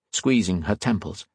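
ordinary delivery

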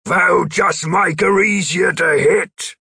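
slow, loud, high shout; angry shout. A narrator's reading. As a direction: shout the order at him. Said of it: "Gripper shouted".

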